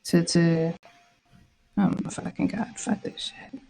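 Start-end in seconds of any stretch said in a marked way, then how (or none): 1.8-3.6 breathed under the breath, much softer; real whispered swear; whispered curse. Real, not acted. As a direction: hiss it to yourself in disgust. Order not filling, cursing aside.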